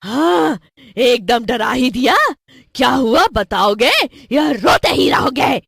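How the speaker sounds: loud, irritated witch voice; scolding witch. Acted. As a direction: scold the crying children as a wicked witch. Witch scolds crying children.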